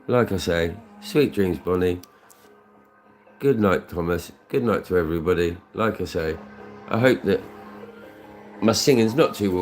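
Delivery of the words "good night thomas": usual speaking voice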